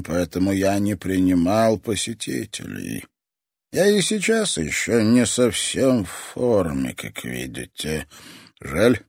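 put-on a menacing old man savouring every word; creepy old man voice. Old toy master speaks.

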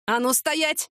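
a neighbour suddenly barks an order; sudden sharp command. A narrator's reading. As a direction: switch to a hard new tone. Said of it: "крикнула Людмила совсем другим тоном".

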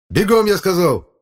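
high, loud furious shout; yelled order. A narrator's reading. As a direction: bellow at a stubborn brother to move. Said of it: "заорал я".

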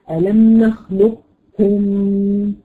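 ألم نخلقكم very slowly with the kaf doubled; slow merged recitation. Shown as a demonstration. Teacher models the preferred reading slowly. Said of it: "يعني طبعًا بالبطء".

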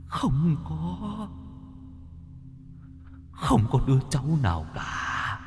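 soft, drawn-out ghostly moan, long pauses; a weeping spirit's faint, halting plea; mournful dead man's voice, fading away; tearful ghost. A narrator's reading. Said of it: "Ông khẽ lắc đầu run rẩy, chảy nước mắt".

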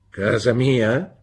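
slow, loud, incredulous repeat; indignant echo. A narrator's reading. Husband repeating his wife's words, slow and indignant. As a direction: drag it out in cold disbelief. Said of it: "ripeté mister Marston".

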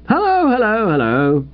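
high, lilting hello that steps down; bright greeting. A narrator's reading. Bingo to his stranded wife and friends, bright. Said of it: "said Bingo brightly".

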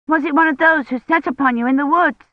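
put-on a wide-eyed little girl asking; curious child asking Kivrin; little girl Agnes. A narrator's reading.

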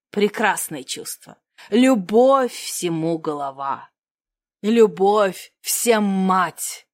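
низко и очень медленно, декламируя; вещай как трагик на сцене; пафосная речь. Acted. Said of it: "Он взялся за пафосные речи". (напыщенная декламация)

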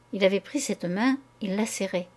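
ordinary delivery, nothing marked

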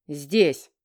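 low, loud growl; growled command. Acted. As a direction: growl the order like a beast. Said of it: "рыкнул король".

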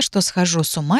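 ordinary delivery, nothing marked

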